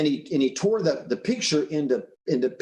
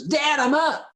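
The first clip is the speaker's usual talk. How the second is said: put-on much higher-pitched kid calling Dad; eager little boy; 13 semitones higher than usual. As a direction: imitate a boy announcing himself to his father. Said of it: "little boy pulls the paper down and goes".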